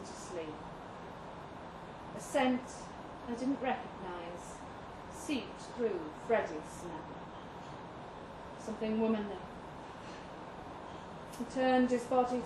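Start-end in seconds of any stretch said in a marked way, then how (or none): none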